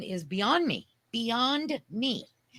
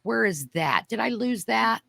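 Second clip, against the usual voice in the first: normal talking voice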